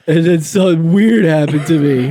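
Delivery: stifle laughter while telling it; giggling confession; laughing through words. Retold or imitated. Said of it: "You're like".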